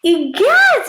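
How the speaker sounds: high, loud startled gasp of a word; mother startled; alarmed exclamation. A narrator's reading. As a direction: cry it out in shock. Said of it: "Mother explained".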